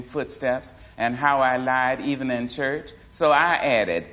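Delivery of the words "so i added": usual speaking voice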